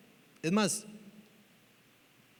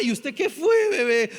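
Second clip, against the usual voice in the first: put-on raised, higher voice fussing over baby; doting parent; 6 semitones higher, 5 dB louder than usual. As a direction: imitate a parent rushing to baby. Parent rushing to crying baby. (padre atento con su bebé)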